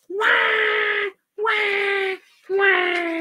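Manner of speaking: high, stretched mock wails; yelling baby. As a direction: bawl like a baby. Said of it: "yelling as loudly as possible".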